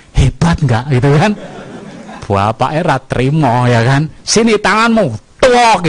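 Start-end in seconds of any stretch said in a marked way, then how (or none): 4.2-5.9 retold angry father, higher and louder on tuok. Father punishing his son for stealing candy, in a retold angry voice. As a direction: snap at the child, demanding his hand.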